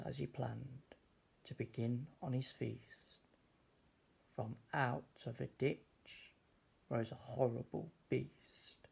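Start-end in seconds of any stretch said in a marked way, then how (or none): none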